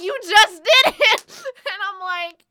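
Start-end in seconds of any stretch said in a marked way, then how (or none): 0.0-1.3 loud, high mock-angry yell; angry, louder. Annoyed lady yelling at a worker, loud mock anger. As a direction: shout it like she caught you lying. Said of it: "she got louder and angrier".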